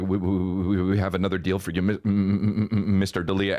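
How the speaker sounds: stumbling, repeating the first sounds; nervous stammer. Retold or imitated. Agent addressing his star client, nervous stammer. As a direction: stutter nervously through the words. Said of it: "because he's nervous".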